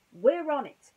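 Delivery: high, loud reassuring yell. Acted. Ham yelling from the top of the stairs, high and loud. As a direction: shout it down the stairs.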